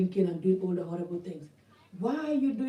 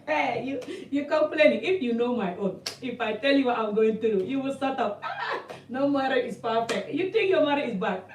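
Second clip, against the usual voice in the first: imitating a scoffing woman, higher and laughing; hammed-up, higher-pitched one-upping with laughs; mock scoffing; 3 semitones higher than usual. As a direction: laugh off her complaint in mock disbelief. Acts women scoffing at complainer.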